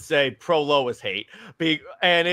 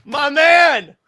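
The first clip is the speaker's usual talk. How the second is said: hyped; 10 semitones higher, 10 dB louder than usual. Real, not acted. Hyped cheer.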